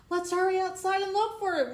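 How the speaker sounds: put-on high, loud eager child voice; excited child. A narrator's reading. Child begs friends to search for the bell, high and loud. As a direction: squeal it like a kid desperate to go look. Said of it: "one of the children said".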